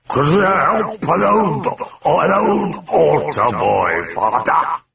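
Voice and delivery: high, slow mocking croak; croaking demon. Demon mocks the priest, croaking. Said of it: "it croaked".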